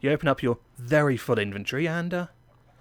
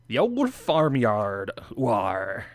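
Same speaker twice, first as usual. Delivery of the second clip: put-on slow, drawn-out English farmyard accent; rural English accent; much slower than usual. Host from England announces his farmyard build, mock rural English accent. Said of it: "I'm allowed to do that accent".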